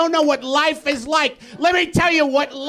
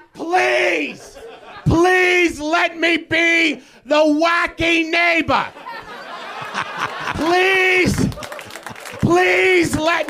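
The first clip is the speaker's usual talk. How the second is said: begging NBC; 3 semitones higher, much slower than usual. Retold or imitated. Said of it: "I'm just like".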